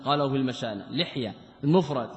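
ordinary talk

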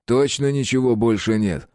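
ordinary delivery, nothing marked

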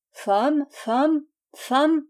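Kedril echoes a question about his wife, high and questioning. A narrator's reading. The drinker echoes the question about his wife, high and questioning. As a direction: raise each word high, like a puzzled echo. Drunk man's repeated questioning cry.

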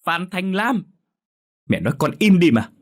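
high, loud full-name yell; mother shouting her daughter down; angry shout. A narrator's reading. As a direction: bark her name to stop her.

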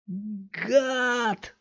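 high, sharp cry of fury; enraged yell. A narrator's reading. Punched thug howls in fury at Scott. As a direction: lash out with the word. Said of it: "завопил в ярости тот".

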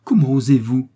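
s'écrier, outrée et plus fort; indignée. A narrator's reading. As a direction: lancer l'indignation d'un coup. Indignation de la servante accusée. (la servante outrée, indignée face à l'accusateur)

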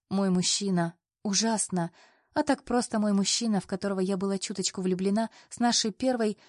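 ordinary delivery

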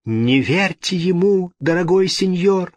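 high, pleading outcry; desperate cry. A narrator's reading. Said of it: "вскричала итальянка".

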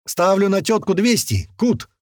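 high, excited call; eager bet. A narrator's reading. Bystander eagerly calls a bet.

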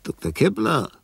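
high, loud call. Acted. Master calling loudly for Doctor Kepler.

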